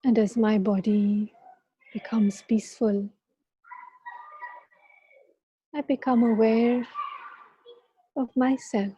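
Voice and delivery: calm guiding voice with long pauses; guided meditation. Acted. Leads a meditation.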